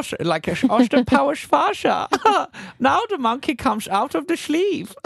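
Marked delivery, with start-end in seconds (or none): 0.5-2.1 high, slushy Dutch accent with sh for s. Shown as a demonstration. Co-host thinking of Austin Powers, high Dutch accent. 2.8-4.9 high, hammed-up Dutch accent; comic Dutch with slushy s sounds. Shown as a demonstration. Co-host reads a quiz sentence for Netherlands, high Dutch accent. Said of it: "What about the Netherlands?".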